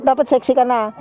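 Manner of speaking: normal talking voice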